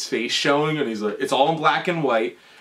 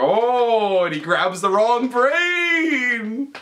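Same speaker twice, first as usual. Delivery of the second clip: mock-dramatic cry; 7 semitones higher, much slower than usual. Dramatic retelling of film blunder.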